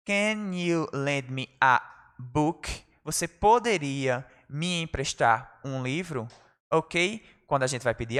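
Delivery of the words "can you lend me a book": very slow, careful classroom English; slow model phrase. Shown as a demonstration. Teacher showing class how to borrow, very slow. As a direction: draw out each word for learners. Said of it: "teacher models phrase slowly".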